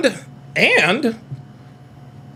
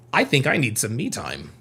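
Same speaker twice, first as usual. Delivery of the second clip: mock-guilty, quieter admission; hushed confession; 6 semitones lower, 6 dB quieter than usual. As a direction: drop the voice, softer, like a guilty secret.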